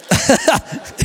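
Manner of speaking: short real laugh at his own slip; embarrassed laugh. Real, not acted. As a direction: laugh it off, caught out.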